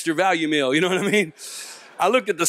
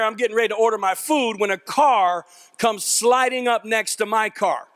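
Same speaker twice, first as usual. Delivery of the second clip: ordinary talk, nothing marked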